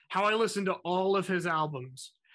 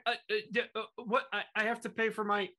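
flustered stammer; 3 semitones higher, 3 dB quieter, faster than usual. Retold or imitated. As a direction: stumble over every word, thrown by the offer. Retelling being flustered by celebrity's offer.

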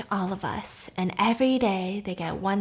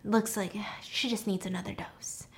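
whispering; 12 dB quieter than usual. Retold or imitated. Doctor's aside, unvoiced whisper.

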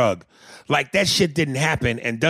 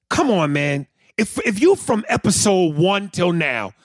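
usual speaking voice

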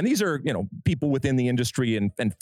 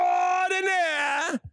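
hype; slower than usual. Acted. Hyped introduction of cohost.

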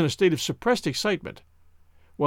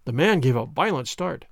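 usual speaking voice

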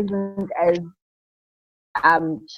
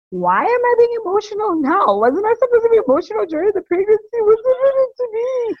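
much higher, fast, hammed-up tearful whine; retold emotional wail. New mother mimicking her emotional self to interviewer, high mock whine. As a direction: play up her own overwhelmed confusion. Retelling surprise at postpartum emotions.

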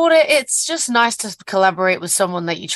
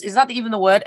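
usual speaking voice

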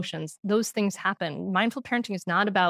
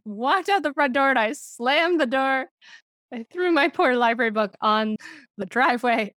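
ordinary delivery

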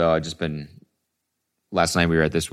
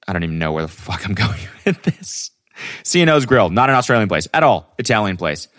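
ordinary talk

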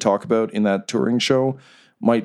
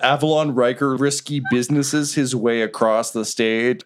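ordinary talk, nothing marked

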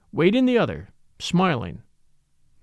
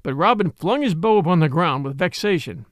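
ordinary delivery, nothing marked

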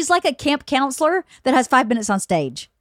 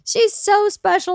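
slow, higher fond praise; tender; 8 semitones higher, slower than usual. Real, not acted. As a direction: linger fondly on special.

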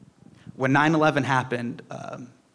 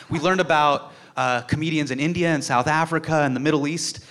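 ordinary delivery

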